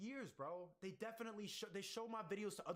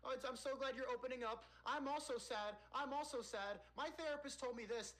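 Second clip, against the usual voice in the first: mock gushing commenter; 7 semitones higher than usual. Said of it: "be like".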